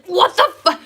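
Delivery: high, loud cut-off curse; mock irritated outburst. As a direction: burst out in exaggerated disbelief. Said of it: "and then I get irritated".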